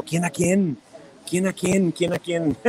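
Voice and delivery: teasing singsong question; playful teasing. Real, not acted. Streamer teasing a viewer about a joke. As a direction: tease it back playfully.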